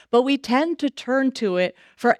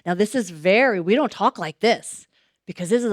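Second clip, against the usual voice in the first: ordinary talk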